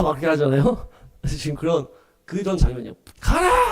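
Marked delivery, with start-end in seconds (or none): 3.2-3.7 very high, drawn-out order; commanding cry. Acted. Command as heaven's gate opens, high commanding cry. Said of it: "하면서 문이 쫙 열리는".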